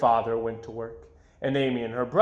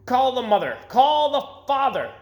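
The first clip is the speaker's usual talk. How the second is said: high, loud yell; 11 semitones higher, 6 dB louder than usual. A narrator's reading. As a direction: shout for the parents. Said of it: "yelled the principal".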